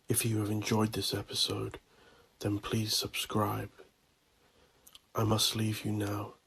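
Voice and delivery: normal talking voice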